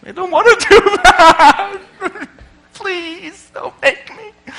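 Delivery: high, whimpering mock plea; mock pleading. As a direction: beg not to be forced, half crying. Pleading to Clippy.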